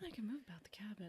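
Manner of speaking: whispering "I can move about the cabin", fast and breathless. Pretend. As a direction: hush it like a secret joke. Echoing a cabin announcement.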